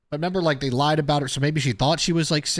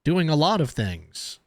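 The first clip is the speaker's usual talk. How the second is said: higher-pitched mock protest, slowing at the end; imitated contradiction; 6 semitones higher than usual. Podcaster voicing the man disputing her story, high imitated objection. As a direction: push back in someone else's words, pitched up. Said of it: "this guy's saying".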